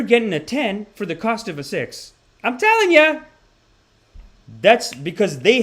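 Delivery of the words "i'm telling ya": high, loud insistence; emphatic. Real, not acted. Comic telling the crowd, high emphatic insistence. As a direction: drive the point home.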